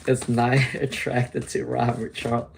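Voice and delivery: soft laugh breaking into the question. Real, not acted. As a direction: giggle mid-question, trying to keep a straight face. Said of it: "I don't know why I'm laughing".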